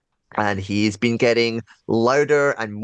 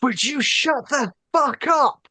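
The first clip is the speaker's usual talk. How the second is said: high, drawn-out exasperated yell; a held-back yell, pitched way up; mock shout; slower than usual. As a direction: shout it high, stretching each word. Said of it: "he just gets up and he shouts".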